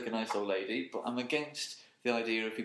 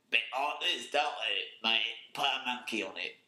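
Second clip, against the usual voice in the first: mock Cockney. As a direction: do the generic Cockney voice. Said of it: "they all sound like".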